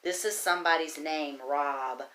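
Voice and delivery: normal talking voice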